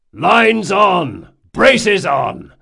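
loud, high deck commands; shouted orders. A narrator's reading. First mate to invisible deckhands, shouted. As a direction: bellow each order to the hands.

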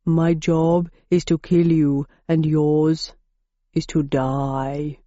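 slow, low menacing bull monster; deep Minotaur voice. A narrator's reading. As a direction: drag it out, low and threatening. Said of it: "said the Minotaur in a basso profundo".

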